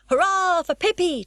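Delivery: high, loud cheer of a crowd. A narrator's reading. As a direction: cheer with the whole circus. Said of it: "and so they shouted".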